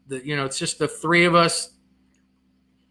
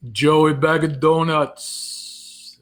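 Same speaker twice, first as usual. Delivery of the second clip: very slow, stretched Jersey Italian; Italian-American Jersey voice, leisurely; Jersey Italian accent; much slower than usual. Shown as a demonstration. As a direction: drag it out in a New Jersey accent. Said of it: "That sounded more like Jersey than New York".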